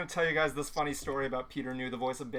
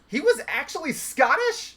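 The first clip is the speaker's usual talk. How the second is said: high, loud, drawn-out incredulous question; retold shock, voice shooting up; astonished disbelief; 11 semitones higher, 7 dB louder, slower than usual. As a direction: blurt it out in amazed disbelief. Retells voice actor's astonished reaction.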